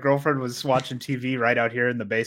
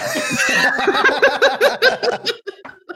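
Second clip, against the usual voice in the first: big laugh; 4 dB louder than usual. Real, not acted. Laughing after retold scream.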